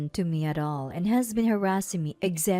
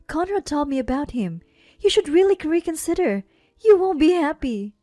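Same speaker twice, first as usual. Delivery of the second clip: higher voice, building louder; insistent pleading; 10 semitones higher, 7 dB louder than usual. A narrator's reading. Older sister urges bride to reconsider, insistent.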